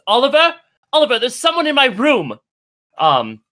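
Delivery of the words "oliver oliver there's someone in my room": high, loud cry of alarm; calling for help. Acted. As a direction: summon help urgently in a raised voice. Noblewoman calls for help.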